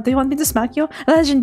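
ordinary talk, nothing marked

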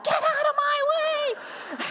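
high, slow hammed-up yell; mock shout. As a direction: clear the airport crowd, playfully. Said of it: "I'm like".

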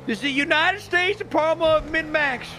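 slow high hillbilly voice; hillbilly accent. Acted. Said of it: "should I do it in the hillbilly voice".

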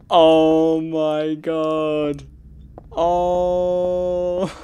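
long amazed gasp of words; drawn-out awe. Real, not acted. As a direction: stretch it out in stunned awe. Shocked by the game atmosphere.

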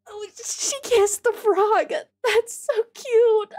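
high, drawn-out squealing; cute squeal. Real, not acted. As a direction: gush like seeing a puppy. Squealing over cute frog kiss.

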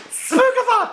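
Spookathon squealed very high with a laugh; gleeful high Spookathon; delighted word. Pretend. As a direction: relish saying Spookathon, pitch jumping way up. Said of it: "I just love saying the word Spookathon".

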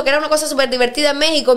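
normal talking voice

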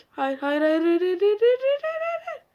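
frantic denial climbing in pitch; rising panic. Real, not acted. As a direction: panic building to a squeal. Panic rising as jumpscare hits.

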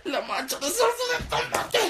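slow, giggly recollection; boy laughing about mom's care; laughing memory. Retold or imitated. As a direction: recall the small kindness fondly.

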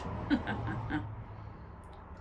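long, gloating chuckle; evil witch laugh. A narrator's reading. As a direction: laugh slowly over the victims. Witch gloats over captured children.

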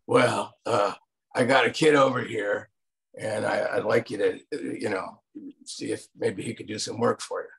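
lightly voiced Eastwood; Clint Eastwood. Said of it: "I don't do a very good Clint Eastwood".

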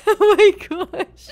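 breaking up with laughter; slow, high words squeezed through laughter; laughing. Real, not acted. Player cracks up at villain's exit, laughing hard. Recovering from own villain act.

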